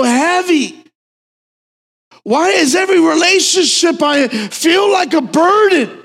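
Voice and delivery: high, loud, slow preaching cry; impassioned shouting. Acted. Preacher voicing weary people's complaints, shouted high and slow. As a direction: cry it out over the congregation.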